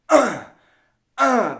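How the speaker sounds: short, loud, high grunt; tennis grunt. Shown as a demonstration. Teacher imitating a tennis player going "uh", loud grunting. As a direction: grunt like a player hitting the ball. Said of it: "like a tennis grunt".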